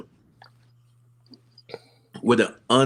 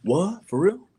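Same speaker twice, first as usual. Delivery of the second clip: high shocked disbelief; surprised; 9 semitones higher than usual. Real, not acted. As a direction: gasp out a high what. Shocked reaction.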